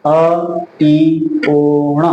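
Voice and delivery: अ, ई, ओ, ण each held separately; one by one. Shown as a demonstration. Teacher reciting the first sutra for students, letter by letter. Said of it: "इन्हें क्रम से बोलेंगे और एक एक बोलेंगे".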